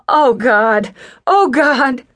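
anguished as it hits her; woman realizing her ex is dead, anguished; distraught. A narrator's reading. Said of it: "She covered her face with her hands".

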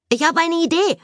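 high-pitched excited call. A narrator's reading. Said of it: "rief Elliot".

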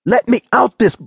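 high, heated demand; angry outburst. A narrator's reading. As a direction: lash out, then break off mid-sentence. Furious demand, cut off mid-sentence.

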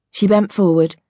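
ordinary delivery

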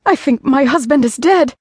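high, strained distress; panicked. Acted. Wife telling the housekeeper her husband is dead, panicked. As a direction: gasp it out in panic.

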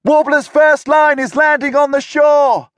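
high, loud alarm shout. A narrator's reading. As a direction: call out the landing at full voice. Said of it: "Alex shouted".

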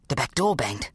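plain reading voice

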